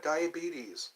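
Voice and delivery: ordinary talk, nothing marked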